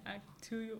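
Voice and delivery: actually as "ak-too-ully"; actually with T instead of CH; T pronounced. Shown as a demonstration. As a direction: sound a clear T in actually. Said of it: "There's a T in there".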